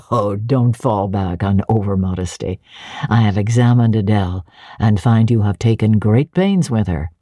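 lightly voiced brusque gentleman. A narrator's reading. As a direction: speak down as a brusque master. Rochester dismisses modesty.

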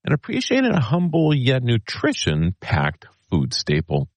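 bright announcer read; old newsreel narrator. Acted. Holiday segment intro read.

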